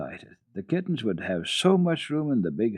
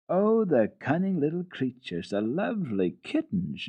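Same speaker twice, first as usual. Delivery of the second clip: delighted; 6 semitones higher than usual. Acted. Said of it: "screamed with delight".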